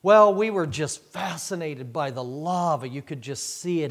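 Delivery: ordinary talk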